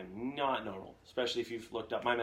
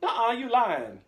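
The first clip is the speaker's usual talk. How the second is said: much higher, louder mock disbelief; disbelieving actor; 11 semitones higher, 8 dB louder, slower than usual. Actor told the Scottish accent is fake, high loud disbelief. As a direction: blurt it like you won't believe the reveal. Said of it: "he goes".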